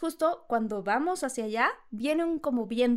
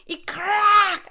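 crack sound; 9 semitones higher, 10 dB louder than usual. Retold or imitated. Sound of hitting cactus.

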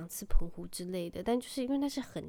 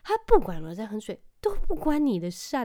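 louder scolding mother; angry mom; 2 semitones higher, 7 dB louder than usual. Retold or imitated. Mom scolding her son Hunter, with loud anger. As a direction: scold the toddler sharply. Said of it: "然后我就很生气".

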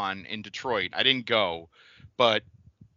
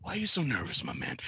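much softer, husky Jake voice; Jake Roberts impression; 9 dB quieter than usual. Shown as a demonstration. Teaching the co-host the Jake line, softly. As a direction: hush it like Jake the Snake. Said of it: "You gotta look and go".

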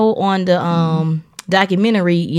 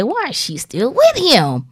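higher, slow mock questioning of a friend's choice; imitating puzzled relatives; 6 semitones higher, slower than usual. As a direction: mimic nosy family asking, drawn out and higher. Quotes disapproving friends and family.

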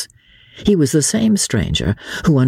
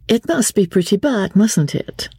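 high, coy society lady; arch; 10 semitones higher than usual. A narrator's reading. Lady teases her visitor about her boredom, arch and high. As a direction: ask it archly, pitched well up. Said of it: "she asked archly".

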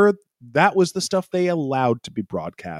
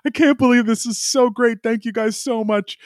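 much higher, mock-thrilled gushing; gushing Mick; 9 semitones higher than usual. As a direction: imitate an overjoyed rookie thanking the team. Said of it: "And Mick is like".

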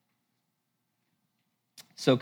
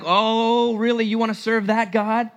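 mocking Satan; 7 semitones higher, 4 dB louder than usual. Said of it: "swing at her fundamentalist piñata and be like".

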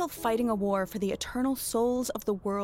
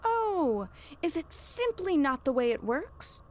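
high, drawn-out sarcasm; mocking echo; 6 semitones higher, slower than usual. Witch echoes reverend's excuse, mocking. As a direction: throw the excuse back with exaggerated wonder.

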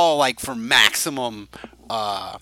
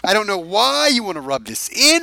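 higher and louder, hammed-up wounded protest; mock hurt; 9 semitones higher, 7 dB louder than usual. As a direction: play the wounded victim, loud and high. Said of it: "I am hurt in my moment".

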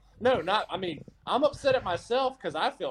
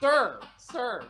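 mock innocent; 5 semitones higher than usual. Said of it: "you're like".

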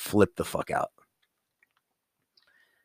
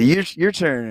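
daughter quoted, high; 8 semitones higher, 4 dB louder than usual. Retold or imitated. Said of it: "You said".

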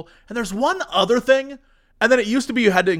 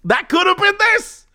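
excited; 10 semitones higher than usual. Real, not acted. Excited pitch to friend.

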